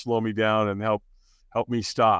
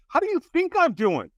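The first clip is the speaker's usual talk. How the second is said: angry retort; 11 semitones higher than usual. Retold or imitated. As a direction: bite the caller's head off. Hungover man snapping at caller.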